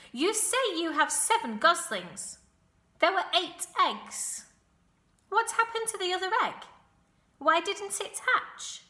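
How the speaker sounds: high, loud, sharp interrogation; sharp-voiced rat. A narrator's reading. Templeton questioning the goose about the eggs, sharp voice. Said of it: "in his sharp voice".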